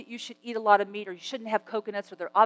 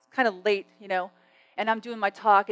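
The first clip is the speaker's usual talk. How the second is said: usual speaking voice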